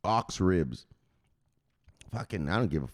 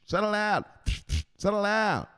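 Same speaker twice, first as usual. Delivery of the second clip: high-pitched enforcer scolding; Richetta; 12 semitones higher than usual. Acted. Enforcer to a nervous seller, high scolding command. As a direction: order them to settle down, pitched high. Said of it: "don't fuck around and have Richetta come for you".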